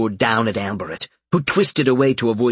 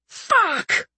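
sharp hissed curse, pitched up. A narrator's reading. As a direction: spit the curse out in pain. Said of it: "Albert hissed".

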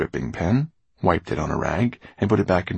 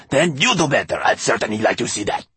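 high, loud, injured retort; sharp; 5 semitones higher, 5 dB louder than usual. A narrator's reading. Glass man dares his master, sharp. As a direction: throw the criticism back sharply. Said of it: "he added sharply".

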